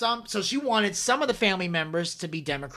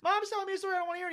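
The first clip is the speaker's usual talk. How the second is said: put-on high-pitched whiny kid complaint; mock screaming; 10 semitones higher, much faster than usual. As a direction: imitate an upset boy tattling.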